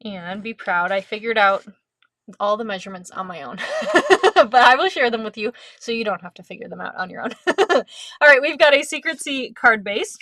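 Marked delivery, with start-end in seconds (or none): 3.6-4.3 high giggle. Real, not acted. Proud of own measurements.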